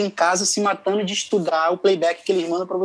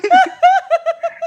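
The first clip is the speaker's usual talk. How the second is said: giddy laugh; 6 dB louder than usual. Retold or imitated. Reenacting his reaction.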